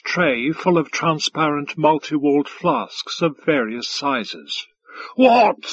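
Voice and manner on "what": loud, high yelled question. A narrator's reading. Five Tide to the waiter, yelling. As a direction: yell it brusquely at the servant. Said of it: "yelled Five Tide".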